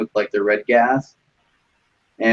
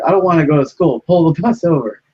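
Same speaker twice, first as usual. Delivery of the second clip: higher, louder mock demand; retold kid's demand; 7 semitones higher, 4 dB louder than usual. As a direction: imitate a kid demanding from the bus driver. Acting out armed kid demanding.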